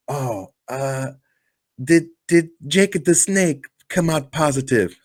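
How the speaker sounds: hesitant, high, badly faked foreign accent; hammed-up worried wrestler, fishing for names; Iron Sheik. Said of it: "your Iron Sheik is awful".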